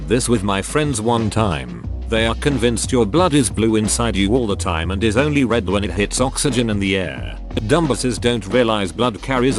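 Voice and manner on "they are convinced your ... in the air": ordinary delivery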